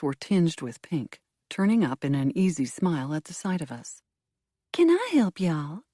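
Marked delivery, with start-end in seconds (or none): 4.7-5.8 high Southern drawl, a little louder. A narrator's reading. Young hostess greets detectives at the door, Southern drawl. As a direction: drawl it out sweetly. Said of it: "she asked in a drawl".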